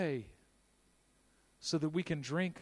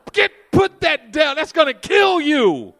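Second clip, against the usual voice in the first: slow, high, loud yell; 10 semitones higher, 17 dB louder, slower than usual. Retold or imitated. Bodybuilder yelling at a stranger eating junk food, slow and loud. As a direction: slap the food away with your voice. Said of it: "And yells at people".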